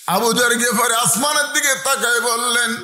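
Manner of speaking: impassioned sing-song preaching; melodic sermon storytelling. A narrator's reading. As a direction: tell it as a chanting preacher, stretching line endings. Preacher tells story in chant.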